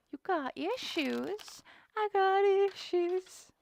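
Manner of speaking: high, soft singsong; mock-concerned teasing. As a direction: coo slowly in fake concern.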